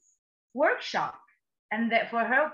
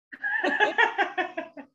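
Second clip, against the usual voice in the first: laugh; 11 semitones higher than usual. Real, not acted. Laughing after the slip.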